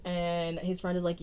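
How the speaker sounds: usual speaking voice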